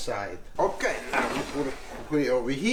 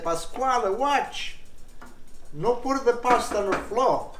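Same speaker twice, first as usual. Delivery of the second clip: mock warning; 6 semitones higher than usual. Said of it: "everybody say".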